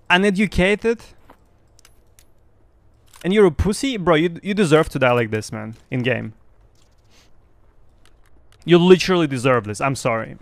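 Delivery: normal talking voice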